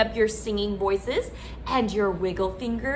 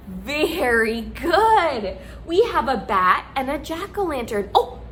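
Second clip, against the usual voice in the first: ordinary delivery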